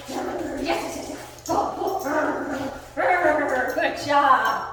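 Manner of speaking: excited play-growly urging of a dog; lively, fun. Real, not acted. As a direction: rev the dog up, ending on loud praise. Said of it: "I'm making it really alive, really fun".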